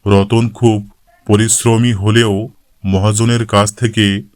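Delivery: usual speaking voice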